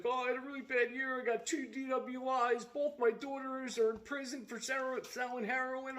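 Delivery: high mock whimper of excuses; whiny sob-story voice. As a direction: play a pathetic guy listing his bad luck. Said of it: "Guy's like".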